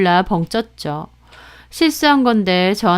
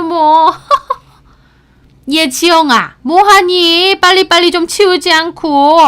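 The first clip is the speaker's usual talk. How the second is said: loud, high-pitched overbearing mother-in-law; bossy mother-in-law; 8 semitones higher, 5 dB louder than usual. Acted. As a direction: play the pushy mother-in-law showing off. Voices bossy future mother-in-law.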